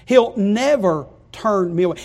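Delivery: usual speaking voice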